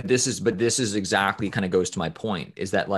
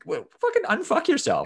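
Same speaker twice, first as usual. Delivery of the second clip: sudden much higher exasperated blurt; exasperated outburst. Acted. Ranting at someone who thinks they're special, exasperated high outburst. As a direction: throw it up in disbelief at their arrogance. Ranting at imagined arrogant person.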